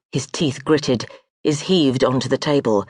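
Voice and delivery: plain reading voice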